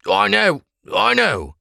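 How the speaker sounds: high, thin voice of a frightened prisoner; pleading prisoner voice. Acted. Prisoner pleading with the Blackcoats, high and anxious.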